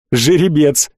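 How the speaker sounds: usual speaking voice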